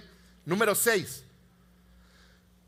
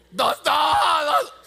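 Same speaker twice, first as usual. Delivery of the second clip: shouted count, much higher and louder; 8 semitones higher, 8 dB louder, slower than usual. Retold or imitated. Father counting strokes aloud at his crying son in the store, shouting. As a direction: bark the count out at the child.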